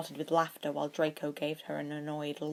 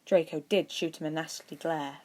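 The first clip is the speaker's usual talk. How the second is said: ordinary delivery, nothing marked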